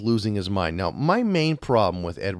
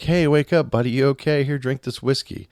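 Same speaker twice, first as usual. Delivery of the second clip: fake friendly; 5 semitones higher, 3 dB louder than usual. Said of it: "pretending to be his best friend".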